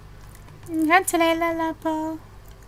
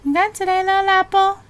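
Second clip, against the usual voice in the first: cooing; 8 semitones higher, 10 dB louder, faster than usual. Real, not acted. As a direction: coo it sweetly. Cooing at game character.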